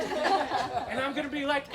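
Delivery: ordinary talk, nothing marked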